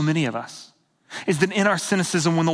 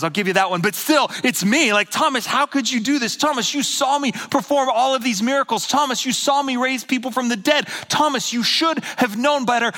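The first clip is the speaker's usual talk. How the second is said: hammed-up exasperated rebuke; incredulous, worked-up telling-off; mock chastising; 3 semitones higher than usual. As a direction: scold a doubting friend in mock disbelief. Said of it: "doesn't chastise or condemn".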